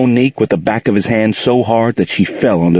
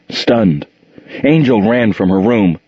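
ordinary delivery, nothing marked

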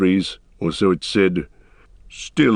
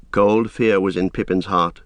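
ordinary delivery

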